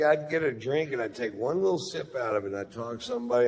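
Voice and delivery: usual speaking voice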